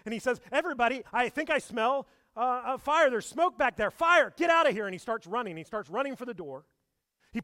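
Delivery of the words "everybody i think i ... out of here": higher and louder, rising into a yell; a hammed-up panic, building to a shout; panicking. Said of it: "He starts panicking".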